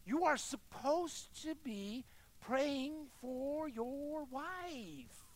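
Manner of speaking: high, very slow, sustained delivery; drawn-out admonishment. Acted. Jesus correcting the preacher over his wife, slow and drawn out. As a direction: stretch each word out high.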